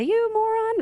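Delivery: high-pitched mock outburst; mock road rage. Driver cut off in traffic, high mock outburst. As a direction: toss out a squeaky, hammed-up insult. Mimics herself insulting a driver.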